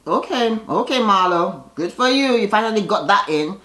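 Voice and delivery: high mocking praise; sarcastic cheer. Real, not acted. To Marlo on screen, high mocking praise. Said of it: "cheering Marlo on".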